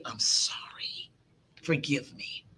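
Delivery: whispered, slow and humble; a whispered, slow I'm sorry; soft whisper. Shown as a demonstration. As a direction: breathe the apology gently. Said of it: "to say".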